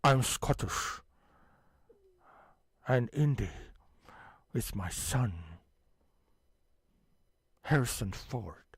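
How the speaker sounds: lightly voiced Scottish father; Sean Connery impression. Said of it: "deep Scottish accent".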